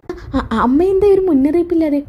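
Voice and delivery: high, nervous stammering greeting; flustered stammer. Acted. Daughter-in-law caught by sudden arrival, flustered.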